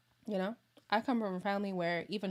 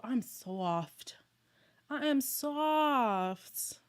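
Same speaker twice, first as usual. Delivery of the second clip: higher, very slow "I am soft"; slow drawn out; 4 semitones higher, much slower than usual. Shown as a demonstration. Insisting to viewers she is sensitive, slow and drawn out. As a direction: stretch each word, playfully.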